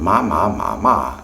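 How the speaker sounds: ma ma ma ma across the four Mandarin tones. Shown as a demonstration. Teacher demonstrating the four tones, precise tone demo. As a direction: say ma in each Mandarin tone in turn. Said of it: "four tones, uh, and a neutral tone".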